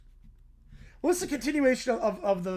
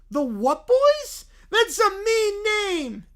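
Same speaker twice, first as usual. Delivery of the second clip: higher, louder and slow with a tearful whine; hurt, whiny kid; 8 semitones higher, 7 dB louder, much slower than usual. Acted. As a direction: whine like a wounded child.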